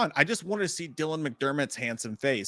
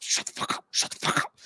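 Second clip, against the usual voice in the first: urgent whispered hush; whispering shut up as he walks by; hushed quote; 5 dB quieter than usual. Retold or imitated. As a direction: hiss it so the celebrity won't hear. Quoting Jay hushing him.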